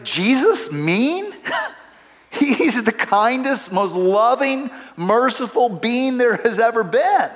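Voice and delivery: high, drawn-out disbelieving question with a laugh; can't-believe-it reaction, half laughing; incredulous. Retold or imitated. Incredulous retort in retold exchange.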